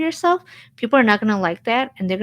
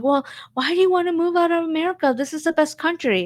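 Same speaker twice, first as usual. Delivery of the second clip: much higher, fast, smug mock question; mocking know-it-all American; 8 semitones higher, faster than usual. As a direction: ham up a smug stay-at-home American, quick and high. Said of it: "Americans who've never traveled outside".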